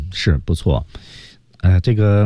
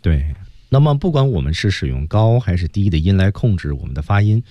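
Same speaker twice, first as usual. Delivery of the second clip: normal talking voice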